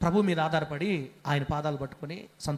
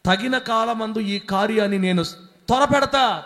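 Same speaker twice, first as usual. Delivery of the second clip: slow, loud, higher proclamation of the promise; booming promise; 4 semitones higher, 7 dB louder than usual. Acted. Pastor repeats God's promise to the church, slow and booming. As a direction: hammer each word home from the pulpit.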